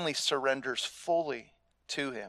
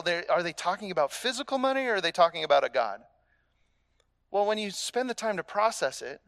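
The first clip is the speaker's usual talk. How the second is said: ordinary delivery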